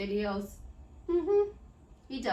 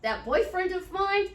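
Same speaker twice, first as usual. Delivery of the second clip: put-on bright high "that boyfriend of mine"; lilting, cheerful and high; higher sing-song; 7 semitones higher, 4 dB louder than usual. Shown as a demonstration. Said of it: "what would you say".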